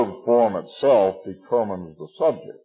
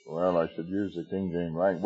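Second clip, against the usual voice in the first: ordinary delivery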